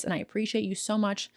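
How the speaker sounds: ordinary delivery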